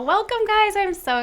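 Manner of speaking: bright, high, rising greeting to her guests; excited welcome. Real, not acted. Mom welcoming her grown kids on air, excitedly. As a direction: welcome them in with real excitement.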